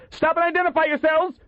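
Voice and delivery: high-pitched shouted challenge; urgent high command; crying out. A narrator's reading. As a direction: order the strangers to halt, alarmed. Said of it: "Waxy cried".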